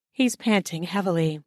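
ordinary delivery

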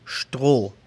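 Stroh with sht and a long drawn O, slowly; Stroh, H silent, O long. Shown as a demonstration. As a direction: stretch the O in Stroh. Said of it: "Escuchamos una O larga".

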